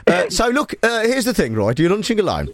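put-on Brummie interviewer voice; Birmingham accent. Host interviews lunching caller, mock Birmingham accent. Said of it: "I'll do Birmingham".

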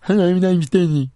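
mumbling boss. Pretend. Co-host role-playing a boss for his colleague, mumbled mock voice. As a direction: garble it like the teacher from Peanuts. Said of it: "like the teacher from Peanuts".

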